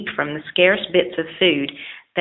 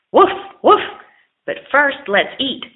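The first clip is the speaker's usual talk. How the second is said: dog bark; 8 semitones higher, 5 dB louder than usual. Acted. Dog barks, then asks food.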